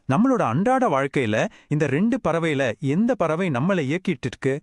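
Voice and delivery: ordinary delivery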